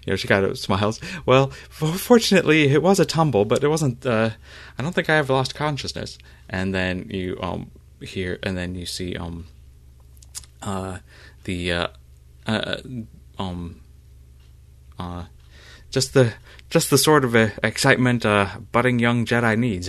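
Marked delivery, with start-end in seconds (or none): 1.3-6.2 put-on higher, louder girlish voice; bright, eager, a bit flustered young Jedi; Dima. GM voices Dima after a tumble. 15.9-19.9 higher and louder eager voice; a keen young initiate, pumped up; young Jedi voice. Acted. GM voices a character aside.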